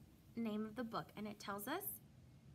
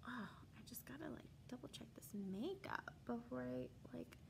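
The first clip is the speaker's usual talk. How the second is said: whispered aside; 2 semitones higher, 7 dB quieter than usual. Real, not acted. Aside while checking makeup.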